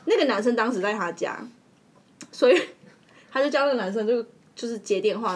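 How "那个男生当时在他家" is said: ordinary talk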